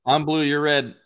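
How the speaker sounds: usual speaking voice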